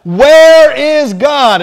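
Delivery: hammed-up aggravated pastor yelling at the boy; big mock yell stretched out. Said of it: "and so he yelled one more time".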